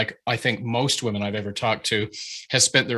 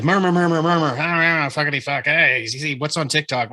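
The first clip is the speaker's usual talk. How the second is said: crowd chatter; 5 semitones higher, 3 dB louder than usual. Retold or imitated. Acting out chattering lecture crowd.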